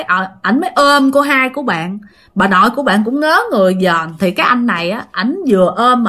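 ordinary delivery, nothing marked

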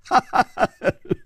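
put-on high rippling old man's laugh; hearty acted chuckle. Old doctor closing his warning, acted chuckle. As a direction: chuckle at your own moral.

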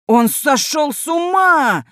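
high, slow furious shout. A narrator's reading. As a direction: yell it out in disbelief. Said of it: "крикнул Георгий Георгиевич".

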